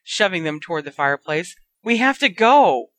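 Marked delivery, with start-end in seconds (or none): 1.8-2.9 loud, higher urgent yell; loud high command shout. Acted. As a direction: yell to get them moving. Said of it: "Harry yelled".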